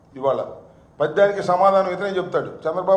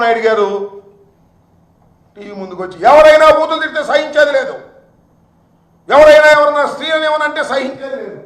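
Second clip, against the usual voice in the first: much higher and louder, shouting in outrage; furious shout; 5 semitones higher, 7 dB louder than usual. Real, not acted. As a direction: blast the accusation at full force. Angry political attack on Chandrababu. (ఆగ్రహంతో గట్టిగా అరుపు)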